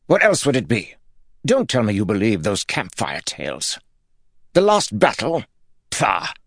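high, contemptuous voice; scornful. A narrator's reading. Lord mocking campfire tales, scornful. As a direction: scoff at the very idea.

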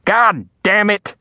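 Daddy yelling as the tray hits him. Acted. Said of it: "Daddy yelling".

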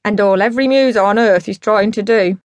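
put-on loud, emphatic drunken lecture; drunk dad holding forth. Drunk dad explaining the muse to Rock Perry, emphatic. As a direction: hold forth like a pub philosopher.

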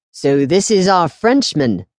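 lightly voiced hearty priest, slow; a hint of a jolly priest greeting the boy; jovial priest. A narrator's reading. Priest greeting the new boy, lightly voiced jovial welcome. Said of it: "the jovial voice came from behind him".

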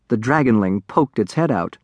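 plain reading voice

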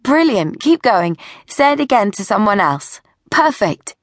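pleased, lifted-voice encouragement; encouraging photographer. Acted. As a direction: cheer the children on between shots. Photographer cheering on her young subjects.

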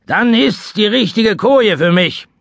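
high, loud, coarse sailor voice; rough old seaman. Acted. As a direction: size up the tavern like a rough sea dog. Seaman decides to stay.

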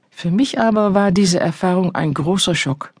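ordinary delivery, nothing marked

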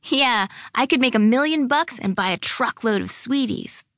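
put-on high-pitched giddy girl voice; amused, gleeful. A narrator's reading. Lily dreams of sweeties with her friend, gleeful. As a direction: play along with childish glee. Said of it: "Lily started to laugh".

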